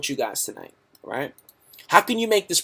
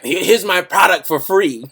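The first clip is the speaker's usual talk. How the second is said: generous fan offer; 4 semitones higher, 7 dB louder than usual. Retold or imitated. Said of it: "this guy hits me up on my fan page like".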